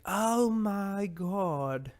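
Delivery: very slow, high, posh British lady; hammed-up British lady accent. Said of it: "Like a British lady".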